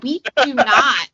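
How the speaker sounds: loud, slow, drawn-out denial; emphatic retort. Acted. Southerner objecting to movie accents, emphatic mock indignation. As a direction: push back hard, spacing out each word. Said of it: "And I'm like".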